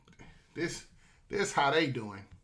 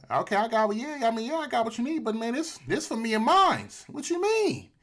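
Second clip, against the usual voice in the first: higher, louder, mock-suspicious hustler; haggling Mexico; 6 semitones higher, 3 dB louder than usual. Mexico brushing off the begging officials, haggling high-pitched. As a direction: play the tough dealer holding out, pitch climbing.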